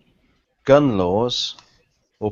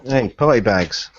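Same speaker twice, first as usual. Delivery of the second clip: ordinary talk, nothing marked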